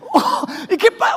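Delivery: ordinary delivery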